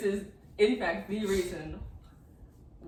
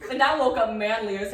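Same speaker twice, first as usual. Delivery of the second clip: ordinary talk, nothing marked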